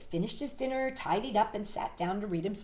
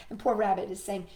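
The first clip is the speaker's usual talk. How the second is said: usual speaking voice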